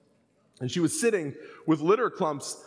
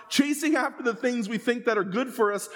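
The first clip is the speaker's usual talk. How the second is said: ordinary delivery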